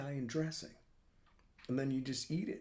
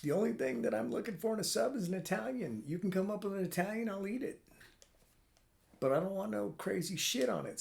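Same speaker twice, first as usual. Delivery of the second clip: raised, emphatic retelling; a lightly pitched-up self quote; telling his kids; 8 semitones higher than usual. Retold or imitated. Father telling his kids what he wants, raised voice. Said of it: "And I was like".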